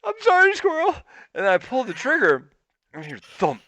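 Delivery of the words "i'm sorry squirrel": very high mock-crying squeal; hammed-up weepy apology, pitched way up; tearful apology. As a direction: wail the apology as if in tears. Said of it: "I'm tearing up and I'm crying".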